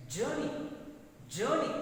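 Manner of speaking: crisp teacher's model of JOURNEY, first syllable stressed; English pronunciation. Shown as a demonstration. Teacher modelling JOURNEY for English learners, English pronunciation. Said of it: "according to Telugu accent".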